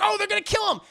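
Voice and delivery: very high, loud panicked cry; hammed-up alarmed exclamation; mock alarm. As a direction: squeal it like a horrified viewer. Acted alarm at the shooting.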